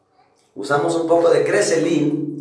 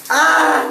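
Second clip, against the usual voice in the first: high startled yelp from cold water; sharp yelp; 12 semitones higher, 5 dB louder than usual. Real, not acted. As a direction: yelp as icy water hits. Gasping under cold shower.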